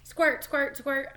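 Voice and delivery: bouncy pretend squirting noises; chirpy make-believe squirts; playful squirt sounds.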